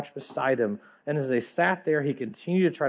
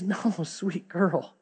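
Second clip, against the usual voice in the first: weeping father; 3 semitones higher, slower than usual. A narrator's reading. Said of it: "he just starts to weep".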